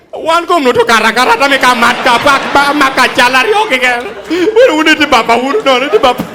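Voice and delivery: high, loud frantic babbling in Luo; hammed-up panicked Luo. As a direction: babble in panic after the slap. Woman babbles in Luo after slap.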